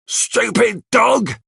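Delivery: high, slow, drawn-out yell of "Stupid dog!"; angry shout at the dog. Acted. Bill yells at his runaway dog, angry high shout. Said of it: "he shouts".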